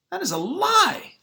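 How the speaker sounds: high, loud cry of protest; outraged. Acted. Sylvia hearing the superintendent lie, outraged high cry. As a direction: burst out against the lie.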